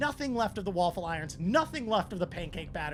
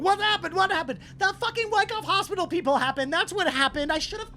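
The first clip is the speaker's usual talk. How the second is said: put-on high, loud agitated Russian accent; 8 semitones higher, 4 dB louder than usual. Game master voices Russians coming inside, agitated Russian accent. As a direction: panic and blame in a Russian accent. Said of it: "bunch of Russian voices going like".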